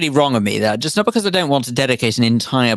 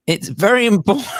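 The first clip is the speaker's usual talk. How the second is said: laughing while reading; 7 semitones higher than usual. Real, not acted. Reading a listener's embarrassing message.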